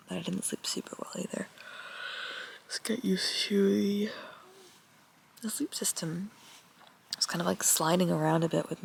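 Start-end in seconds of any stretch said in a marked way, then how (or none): 0.1-6.3 quick soft whisper. Real, not acted. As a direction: whisper so others sleep on. Whispering in tent at dawn.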